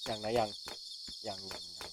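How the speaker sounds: soft, low hushed whisper; whispering while hiding. Real, not acted. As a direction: keep it down, it might hear. Hiding from red-teeth monster.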